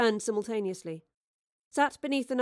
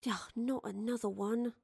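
softer, sighed-out grumble; weary sigh; 2 semitones higher, 6 dB quieter than usual. Acted. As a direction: roll your eyes with a sigh. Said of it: "Astrid sighed".

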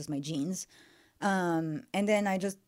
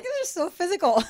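much higher, louder amazed; mock amazement; 12 semitones higher, 6 dB louder than usual. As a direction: play the stunned player. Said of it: "I was like".

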